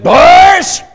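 high, loud, slow shouted command; slow triumphant shout; defiant shout. Retold or imitated. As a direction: bellow it to the whole schoolyard. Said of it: "and I said".

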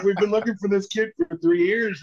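high-pitched retold eagerness, laugh in it; eager explaining. Director telling the kid about his long search, excited.

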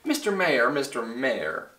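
usual speaking voice

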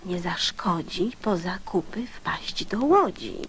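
usual speaking voice

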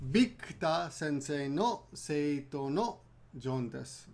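slow model sentence; slow and careful. Shown as a demonstration. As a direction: spell it out slowly. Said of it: "So for example".

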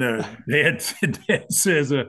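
ordinary talk

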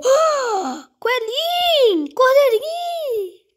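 high, loud, drawn-out cries of the names; slow, call them across the room; excited calling out. Real, not acted. Gamer calls the bunny and lamb characters, excited.

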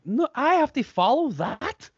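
high, drawn-out mock disbelief; acted incredulity. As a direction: squeal it slowly, as if appalled at the task. Retelling dread of following [name].

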